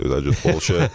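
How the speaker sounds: lightly imitating a Brooklyn guy; Brooklyn accent. Quoting the Brooklyn-born director, light Brooklyn accent. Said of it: "he's from Brooklyn".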